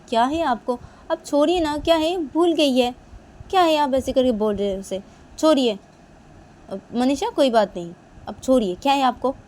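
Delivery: loud, high, exasperated scolding; angry pleading. Acted. Mother defends daughter to father.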